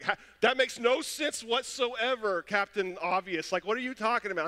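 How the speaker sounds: mocking scoff. Frustrated husband mocking his wife's reboot tip, high and sarcastic. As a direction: sneer at the obvious advice. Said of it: "I'm like".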